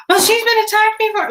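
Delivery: high, loud panicky imitation; mock hysterical. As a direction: mimic a frantic dog owner protesting. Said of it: "this lady was completely hysterical".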